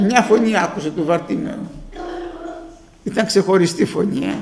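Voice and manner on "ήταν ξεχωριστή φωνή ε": usual speaking voice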